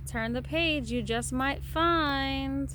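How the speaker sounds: plain reading voice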